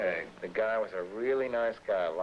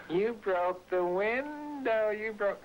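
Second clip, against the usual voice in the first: mimicking Linda; 12 semitones higher, much slower than usual. Retelling Linda yelling.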